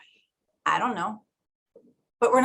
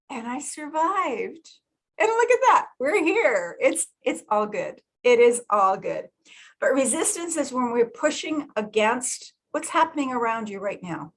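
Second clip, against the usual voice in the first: ordinary talk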